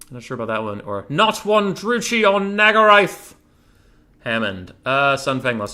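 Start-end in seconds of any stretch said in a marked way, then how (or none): 1.1-3.3 high, loud, drawn-out war cry; battle catchphrase. Acted. Streamer performing a rival game catchphrase, shouted battle cry. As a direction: bellow it like a warlord rallying troops. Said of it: "catchphrase is better".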